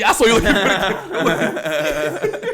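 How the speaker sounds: high, loud laughing outburst; laughing protest. Real, not acted. Host caught being looked at, protesting with laughter. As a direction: protest loudly through laughter.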